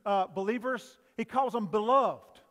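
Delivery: usual speaking voice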